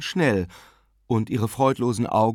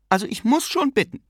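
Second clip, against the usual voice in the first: high-pitched, testy protest; almost irritated; 14 semitones higher, 4 dB louder than usual. A narrator's reading. Aunt remarks on her sister wandering off, almost irritated. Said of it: "sagte sie fast gereizt".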